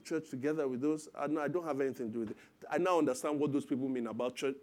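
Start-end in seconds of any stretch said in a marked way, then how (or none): none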